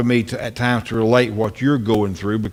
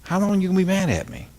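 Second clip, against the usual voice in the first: higher, pleading, hurt lament; Jesus hurt; 7 semitones higher than usual. Retold or imitated. Jesus looking down, pleading with Preston, hurt. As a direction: plead like a hurt friend who asks what he did wrong. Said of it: "he'd say".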